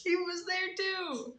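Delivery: high, drawn-out protest; indignant insistence. Real, not acted. Answering a doubting listener about the co-leader, high indignant insistence.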